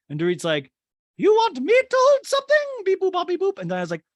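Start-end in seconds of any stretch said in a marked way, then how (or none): 1.2-3.6 very high, loud caricature voice; Dorit. Retold or imitated. Podcaster mimicking Dorit offering help, hammed-up squeal. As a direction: squeal it with robotic beeps tacked on.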